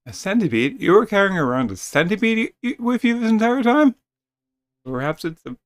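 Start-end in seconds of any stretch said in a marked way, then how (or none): 0.1-3.9 rising, louder and higher in shock; can't believe she carried it; astonished outburst; shocked disbelief. Acted. Shocked by the centipede.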